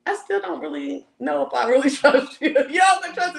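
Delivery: laughing through the words; half-laugh while quoting; laughing quote. Retold or imitated. Quoting him to his face, laughing through it.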